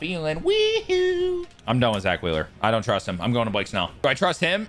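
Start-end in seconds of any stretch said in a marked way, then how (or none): 0.4-1.5 high yelped "Wee-hoo"; gleeful whoop; whooping cheer. Real, not acted. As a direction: whoop it out in glee. Celebrating a play.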